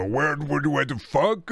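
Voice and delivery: lightly voiced baffled boomer; a hint of muddled boomer outrage; confused boomer. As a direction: stammer it like a lost boomer.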